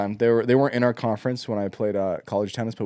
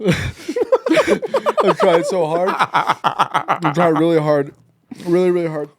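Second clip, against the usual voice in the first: laughing; 4 semitones higher, much slower than usual. Real, not acted. Laughing through pepper pain.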